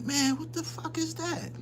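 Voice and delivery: hushed, high startled question. Retold or imitated. Kids in the gym hearing thuds, hushed and startled. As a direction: ask it like something strange is happening next door. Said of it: "We like".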